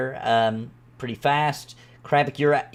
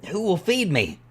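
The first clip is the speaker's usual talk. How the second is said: higher, pleading underling voice; cowed servant; 5 semitones higher than usual. Acted. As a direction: stammer agreement, then ask plaintively. Game master voices cowed servant Summerdog.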